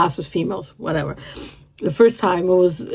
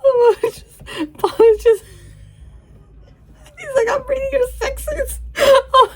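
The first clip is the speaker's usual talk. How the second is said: very high, squeezed-up giddy voice; giddy squeaking. Real, not acted. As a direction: squeak through it, barely holding it together. Helpless giggly reaction to the scene.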